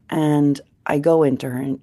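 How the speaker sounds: ordinary talk, nothing marked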